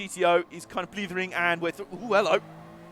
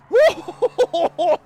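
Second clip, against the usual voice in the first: very loud, high whoop; startled whoa; 13 semitones higher, 12 dB louder than usual. Real, not acted. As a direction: cry out as the car slides. Car slides at final corner.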